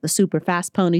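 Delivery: ordinary talk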